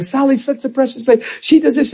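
high, syrupy praise in prayer; mock pleading with a sugary tone; sentimental prayer. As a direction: list her virtues to God. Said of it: "Now you're praying".

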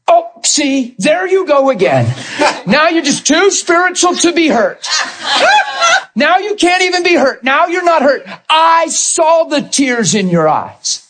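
wife teasing her husband through laughter, high and mocking; mocking wife's retort. Pastor imitating his wife mocking him, high laughing taunt.

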